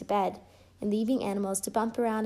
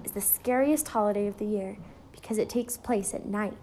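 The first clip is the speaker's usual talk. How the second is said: plain reading voice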